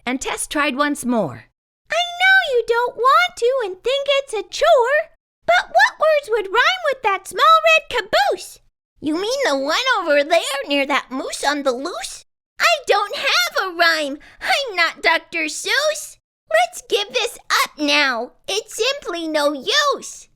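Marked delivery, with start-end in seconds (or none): 1.9-8.6 much higher, louder, cheery tin friend; bright Tess. A narrator's reading. Tess coaxing. 9.0-20.3 worried Ricky. A narrator's reading. Ricky giving up.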